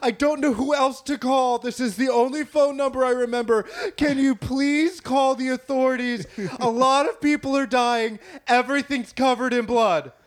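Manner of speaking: a hammed-up teary plea for help; crying on the phone. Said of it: "People calling in".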